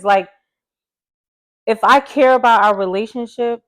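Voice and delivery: ordinary talk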